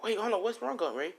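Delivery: high-pitched, worried questioning; acted alarm. Boy asking his crying aunt what's wrong, alarmed. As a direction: voice jumps up in mock concern.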